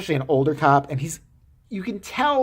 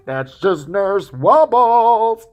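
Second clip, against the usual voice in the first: loud, long-drawn cartoon announcement; goofy mock tagline; 3 semitones higher, 8 dB louder, much slower than usual. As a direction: stretch it out like a sitcom jingle. Mock sitcom tagline after effect.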